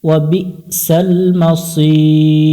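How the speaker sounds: drawn-out reading of the words; slow recited reading. Shown as a demonstration. Teacher slowly reading the phrase to students, drawn out. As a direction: stretch each word slowly as in recitation.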